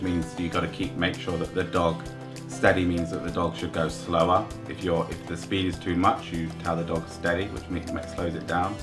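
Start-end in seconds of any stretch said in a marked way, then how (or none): none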